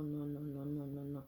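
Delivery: ordinary talk, nothing marked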